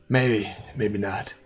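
a hint of a sinister dealer; shady informant. Same shady character again.

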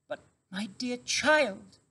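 high, slow, startled mother; a weak mother stunned by food; astonished mother fox. A narrator's reading. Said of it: "she cried".

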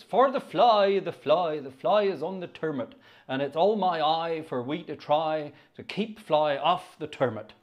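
rustic chorus in a put-on Wiltshire accent; chant-like recital in broad Wiltshire dialect. Organist reciting the turnip hoeing chorus, Wiltshire accent. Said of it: "about as good as my Wiltshire accent gets".

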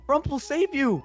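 high, hammed-up rescue cry; mock heroic cry. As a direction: play the hero for a laugh. Heroic cry over dead friend.

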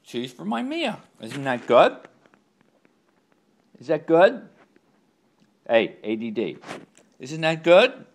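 high, loud sing-song praise. Real, not acted. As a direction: gush over the dog eating.

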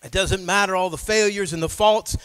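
loud, driving testimony from the pulpit; fervent preaching. Real, not acted. Preaches at full force.

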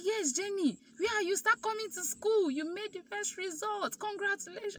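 teacher happily breaks the news; excited congratulations. Retold or imitated.

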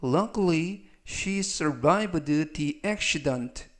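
slow, carefully spaced English sentence; slow model reading. Shown as a demonstration. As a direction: read each word slowly for learners. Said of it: "즉, 전체적 의미는".

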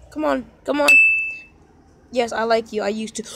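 ordinary talk, nothing marked

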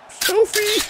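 high, drawn-out silly voice; slow high-pitched mockery; mock selfie-taker. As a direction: imitate someone posing for a selfie, stretching it. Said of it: "that guy on his cell phone".

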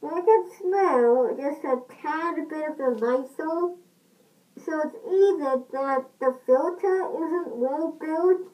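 muffled mask-covered voice; speaking inside rubber respirator; through a gas mask. Real, not acted. Seen 0: Full gas mask with hose worn over face throughout speech.

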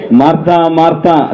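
calling at an everyday pitch; not loud, close by. Shown as a demonstration. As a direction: call the name calmly, as if standing near. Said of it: "దగ్గరుండి అంత గట్టిగా అనరు ఆయన". (దగ్గరగా ఉండి మామూలు స్వరంతో పిలుపు)

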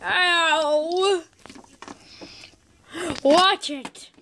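ordinary talk

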